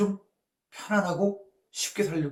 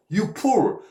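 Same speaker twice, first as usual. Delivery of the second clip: God's rebuke; 6 semitones higher, 6 dB louder than usual. Retold or imitated. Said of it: "이런 얘기예요".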